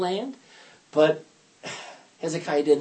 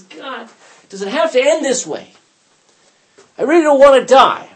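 raised, pleading voice near tears; pleading cry; 9 semitones higher, 10 dB louder than usual. Acted. Hezekiah to God at the wall, crying plea. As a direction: beg God through tears, louder. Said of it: "he begins to cry".

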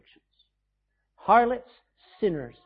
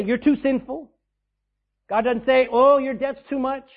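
mock scolding God; 7 semitones higher, 8 dB louder than usual. God voiced rejecting the sinner.

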